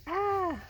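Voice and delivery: scream. A narrator's reading. Bats fly out, scream.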